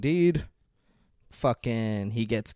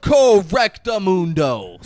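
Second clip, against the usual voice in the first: higher, loud, slow drawn-out approval; hammed-up praise; 9 semitones higher, 11 dB louder, much slower than usual. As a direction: savour the word. Jules praising the answer.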